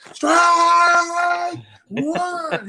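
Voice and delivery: high, booming strike call; showy umpire call. Retold or imitated. Umpire Dutch Rennert hamming up his call, booming shout. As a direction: bellow it like a showman umpire. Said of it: "he had his own show going back there".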